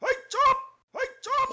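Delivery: very high shout of hush, fading away each time. Acted. Cow silencing the bickering farmers, high yell fading. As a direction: start as a piercing high yell and let each repeat die down.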